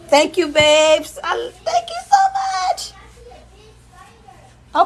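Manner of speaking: high-pitched squealed thank-you; gushing thanks. Real, not acted. Cook thanks a helper, gushing in a high squeal. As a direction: gush thanks, voice shooting up high.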